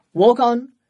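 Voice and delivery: a cabman calling out to his horse; cheery cabbie call. Acted. The driver setting off into traffic, cheery loud call. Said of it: "with a cheery".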